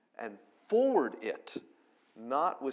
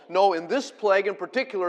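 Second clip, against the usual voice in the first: ordinary talk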